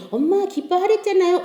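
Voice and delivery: lightly voiced child's protest; reproving child. Child reminds mother in story.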